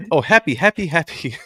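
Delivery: lightly mimicked high-pitched woman; imitating a woman. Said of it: "she's gonna call up later".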